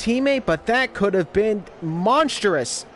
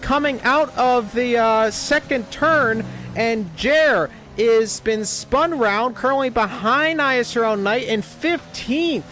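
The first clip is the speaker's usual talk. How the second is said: motorsport commentator; 5 semitones higher than usual. Acted. Crash play-by-play.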